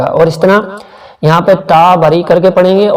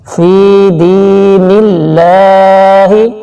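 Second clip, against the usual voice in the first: slow chanted tajweed recitation; Quran recitation; much slower than usual. Shown as a demonstration. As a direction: recite it slowly with the long vowels drawn out. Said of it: "Roman میں دیکھیے".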